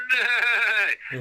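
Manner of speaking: neigh like the cartoon horse mascot; horsey neigh. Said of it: "this little horsey mascot that goes".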